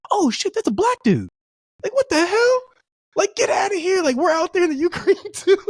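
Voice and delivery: very high-pitched, hammed-up disbelief; astonished outburst. Joking with friend about unexpected face on war footage, astonished. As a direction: squeal it in comic astonishment.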